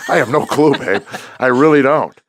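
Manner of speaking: helpless laugh through the words; baffled, laughing answer to his wife; laughing disbelief. Retold or imitated. Laughing reply to wife after weeping.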